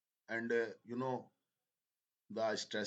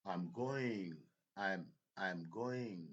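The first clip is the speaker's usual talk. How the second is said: GOING stretched and stressed, "I'm" kept small; much slower than usual. Shown as a demonstration. Teacher showing learners main-verb stress, "going" drawn out and stressed. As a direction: lean on "going", pass lightly over "I'm". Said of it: "more stress rather than helping verb".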